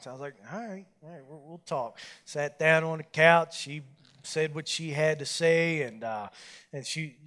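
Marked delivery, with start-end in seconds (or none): 0.5-2.0 reluctant mumble. Retold or imitated. Reluctant agreeing to talk.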